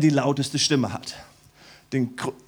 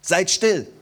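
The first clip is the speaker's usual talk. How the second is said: Seid still, said louder and sharper as a firm order; louder stern hush; stern command; 3 semitones higher, 6 dB louder than usual. Retold or imitated. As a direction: Nehemiah hushing the weeping crowd firmly. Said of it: "Nehemia hat gesagt".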